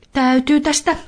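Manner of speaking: put-on high light boy's voice, excusing himself; a boy edging away, voice up high; boy leaving in a hurry, pitched high; young Niko. A narrator's reading. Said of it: "Niko sanoi".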